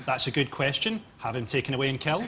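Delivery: lightly voiced low, soft dictator; mock dictator. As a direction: quietly order it, deadpan and low. Mock dictator on Question Time.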